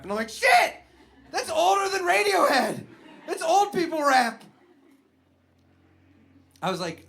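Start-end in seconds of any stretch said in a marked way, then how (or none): 0.3-4.4 high, loud panicked outburst; sudden horror at being old; loud high shocked swear; dismayed shock. Retold or imitated. Realizing he's uncool.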